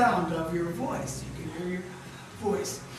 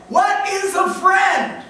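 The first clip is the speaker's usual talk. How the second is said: high, loud, slow preacher's cry; loud question; 6 semitones higher, 5 dB louder, slower than usual. Acted. Preacher booms the question at the congregation. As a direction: call the question out to the crowd.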